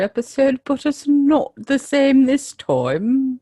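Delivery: mock-posh British accent, drawn out at the end; put-on posh accent. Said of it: "that's a lovely accent".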